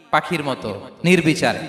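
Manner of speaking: ordinary delivery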